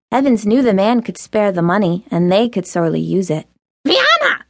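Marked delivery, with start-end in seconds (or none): 3.9-4.4 much higher, shrill squawking call of a name; outraged squawk. Acted. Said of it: "an outraged voice squawked behind her".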